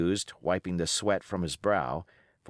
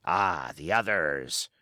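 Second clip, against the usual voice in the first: a scornful pirate captain holding his ground; defiant old sea-cook pirate; 3 semitones lower, slower than usual. Acted. Pirate cook rebutting the mutineers' charges, defiant. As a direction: roll the words out like a seasoned buccaneer defending his command. Silver answers Morgan.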